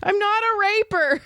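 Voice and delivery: high-pitched wail of protest; indignant high squeal; mock protest. Mock protest after the retold accusation.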